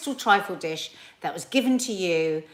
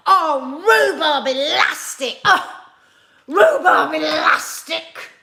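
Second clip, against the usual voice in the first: loud, high, slow theatrical cursing; trying it out; 8 semitones higher, 8 dB louder, slower than usual. Shown as a demonstration. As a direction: curse with full over-the-top gusto. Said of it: "Let me just try that".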